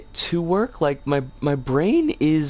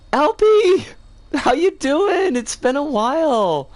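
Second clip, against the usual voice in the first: delighted greeting; 9 semitones higher, 5 dB louder than usual. Real, not acted. Streamer greeting a viewer after a while, excited.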